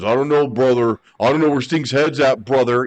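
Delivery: lightly voiced Hogan brushing it off; a hint of Hogan making excuses; Hulk Hogan impression. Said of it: "Hogan didn't wanna lose because".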